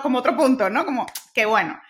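ordinary talk

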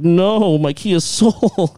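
high, slow mock lament; mock wail. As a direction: drag it out like a hammed-up loss. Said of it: "and everyone's like".

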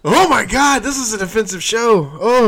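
high, loud, appalled exclamation; scandalized dismay. Real, not acted. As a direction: exclaim as if shocked by the show. Appalled by own show's jokes.

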